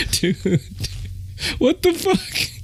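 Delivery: high, soft, squeezed out through laughter; laughing in disbelief. Real, not acted. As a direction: gasp it out while cracking up. Still laughing at the story.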